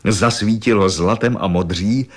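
plain reading voice

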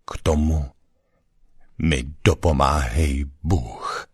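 restrained, formal oath; understated ceremonial gravity; solemn oath. A narrator's reading. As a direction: recite it as a solemn civic formula. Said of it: "pravil jeho hlasem slavnostní formuly".

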